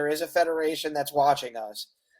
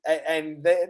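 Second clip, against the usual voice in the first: ordinary delivery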